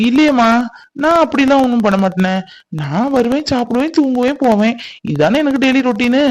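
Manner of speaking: high-pitched, wide-eyed denial; innocent protest. Acted. Husband telling his wife his daily routine, innocently protesting.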